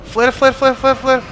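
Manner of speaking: high, repeated urgent cry; urgent panic. Real, not acted. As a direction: fire the word off in alarm. Urgently grabbing flare under attack.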